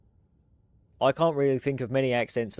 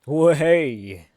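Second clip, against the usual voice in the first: loud startled character voice in a put-on strawberry voice; cartoonish strawberry voice yelp; 2 semitones higher, 7 dB louder than usual. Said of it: "the first time I did this voice".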